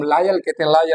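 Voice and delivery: लायल with an "eye" vowel, "lie-al"; common mispronunciation. Shown as a demonstration. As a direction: say loyal wrongly as "lie-al". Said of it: "this is not लायल". (लॉयल को गलत, लायल बोलकर)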